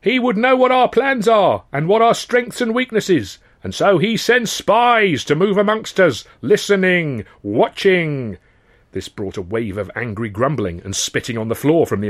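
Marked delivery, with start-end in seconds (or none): 0.0-8.4 high, loud rabble-rousing oration; fiery speech. Acted. Union orator warning dockers about the owners, loud and fiery. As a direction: whip the crowd into fury.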